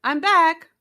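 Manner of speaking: loud, high call on return; cheerful cry. A narrator's reading. Nat returning to her friends, loud cheerful call. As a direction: burst back in and announce it. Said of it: "cried Nat".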